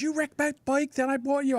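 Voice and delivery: high, incredulous imitation of dad; outraged dad. Dad learning the gift bike is wrecked, high outraged disbelief.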